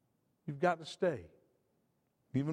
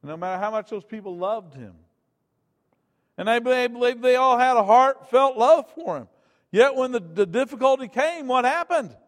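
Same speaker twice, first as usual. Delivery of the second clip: loud, higher preacher's climax; fiery preaching; 6 semitones higher, 13 dB louder than usual. Acted. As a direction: drive it home to the church.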